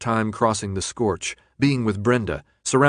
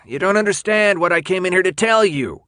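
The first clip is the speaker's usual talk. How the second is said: much higher and louder, pressing hard; raised, exasperated; 9 semitones higher, 5 dB louder than usual. A narrator's reading. Ratman insisting Thomas listen, raised voice. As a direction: lose patience and push it out loud. Ratman repeats, then erupts.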